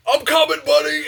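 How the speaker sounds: very high, loud heroic holler; hammed-up buddy charging to help; mock rescuer's shout. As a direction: yell it high, playing the eager sidekick. Teammate rushes to the rescue.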